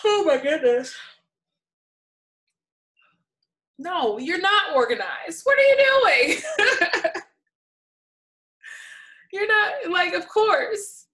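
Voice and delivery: loud, high, laughing disbelief; half-laughing outrage at them; exasperated shout. Real, not acted. Reacting to disorganized parents.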